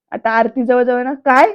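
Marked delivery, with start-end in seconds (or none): 1.2-1.5 a short mock yell of disbelief; retold shout. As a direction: cry out the word in shock, half-acted. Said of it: "असं म्हणून ओरडते". (चकित होऊन हलकेच ओरडलेले "काय?")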